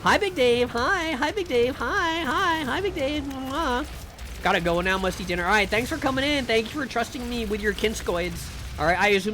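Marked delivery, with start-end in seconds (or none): 0.1-3.8 much higher, sing-song hello to a friend; squeaky cutesy hi with a kiss; high cutesy greeting. Acted. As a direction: coo a sugary hello. Greeting chatter Dave.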